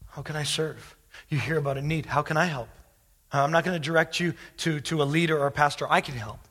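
loud, earnest voice of a volunteer; eager call to serve. Pretend. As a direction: cry out the offer to help like it's urgent. Congregation member's imagined eager offer.

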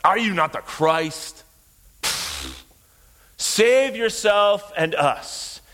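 slow sneering taunt with a dismissive puff; scornful mockery. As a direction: draw it out with open contempt. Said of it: "Or more like".

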